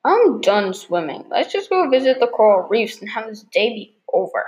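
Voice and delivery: ordinary talk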